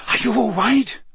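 high, urgent check on a friend; worried, rough-edged question; rough, urgent concern. A narrator's reading. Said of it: "Harry said roughly".

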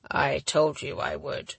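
low, slow, weakened reply; weak injured man. A narrator's reading. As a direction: reassure her feebly, low and slow. Weak injured man reassures her.

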